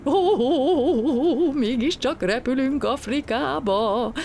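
high, slow delighted cry; rejoicing. A narrator's reading. Artúr rejoices on the plane's tail, delighted. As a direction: savour the joy. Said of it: "újjongott Gombóc Artúr".

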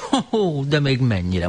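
high, bright-eyed ho-ho; enthused. Acted. Romó eagerly encouraging the old horse. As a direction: burst out delighted.

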